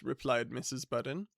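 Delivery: ordinary delivery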